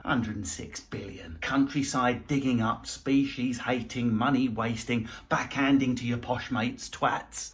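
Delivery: low, soft muttered list of grievances; bitter muttering. Acted. Comic fuming at the spending, in a low muttered grumble. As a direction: grumble it under your breath.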